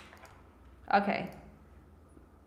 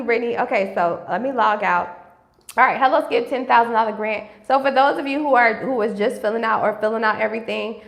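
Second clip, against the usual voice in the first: ordinary talk, nothing marked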